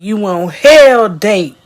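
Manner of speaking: slow, very loud pronouncement; loud slow demonic proclamation; booming announcement. Pretend. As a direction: boom it like a devil's herald. Imagined devil announcing.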